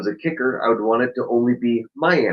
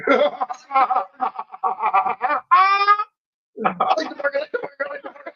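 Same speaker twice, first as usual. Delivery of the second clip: much higher, squeaky talking-dog voice, climbing ever higher; silly dog voice; 14 semitones higher than usual. Pretend. Owner voices his dog's only thought in a squeaky, climbing dog voice. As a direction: play the dog as a yappy little thing chanting its one thought.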